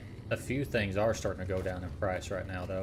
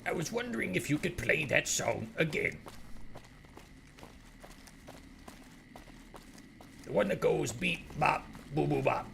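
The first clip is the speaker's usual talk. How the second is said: higher cartoon crab captain voice; Mister Krabs voice; 7 semitones higher than usual. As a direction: ham up a cartoon sea captain. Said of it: "a Mister Krabs impression".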